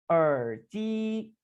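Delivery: 耳机 said with clear Mandarin tones; teacher demo of 耳机; careful Mandarin model. Shown as a demonstration. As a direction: model 耳机 for learners. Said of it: "इसकी practice करेंगे".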